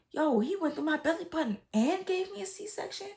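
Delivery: high, stunned "Yo" of disbelief; shocked disbelief. Retold or imitated. Recalling seeing her scar, high stunned disbelief.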